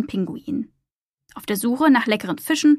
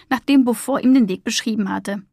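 plain reading voice

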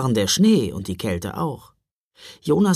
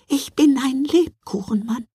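very high tiny creature voice; squeaky little cookie man; gingerbread man voice; 12 semitones higher than usual. Acted.

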